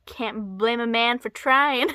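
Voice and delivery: loud imitation of an angry comeback; mock angry retort. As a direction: throw it out loudly, playing at anger. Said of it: "comes back very angry".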